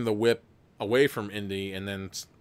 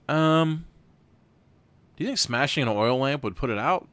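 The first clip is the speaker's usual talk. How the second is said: ordinary delivery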